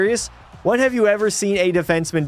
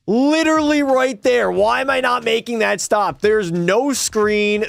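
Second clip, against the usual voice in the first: exasperated rant; 6 semitones higher than usual. Real, not acted. Ranting over missed save.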